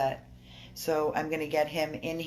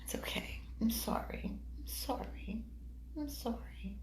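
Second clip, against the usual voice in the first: soft, higher-pitched soothing; tender apology; 6 semitones higher, 9 dB quieter than usual. Real, not acted. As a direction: softly comfort and apologise to a hurt puppy. Apologising to hurting puppy.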